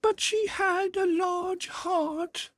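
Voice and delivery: very high, thin otherworldly voice; ghostly spirit voice. A narrator's reading. The Ghost tells Scrooge of his sister, high and otherworldly.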